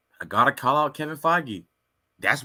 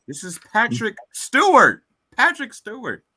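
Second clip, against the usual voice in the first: emphatic rant; 7 semitones higher, 6 dB louder than usual. Real, not acted. Ranting about Patrick Stewart.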